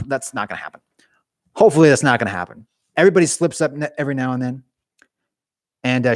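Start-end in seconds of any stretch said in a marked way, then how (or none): 0.1-0.8 hushed, near-whispered aside; under the breath. Real, not acted. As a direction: drop to a soft, whispery murmur. Joking denial muttered under breath.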